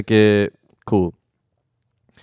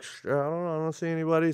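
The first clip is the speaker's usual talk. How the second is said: hushed unsure reply; 7 semitones higher, 11 dB quieter, faster than usual. Retold or imitated. Retold reply while police are near.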